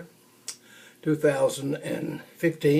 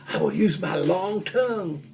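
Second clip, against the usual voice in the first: ordinary delivery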